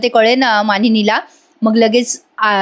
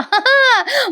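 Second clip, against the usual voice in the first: high-pitched burst of laughter; laughing; 13 semitones higher than usual. Real, not acted. Narrator laughs after episode's ending.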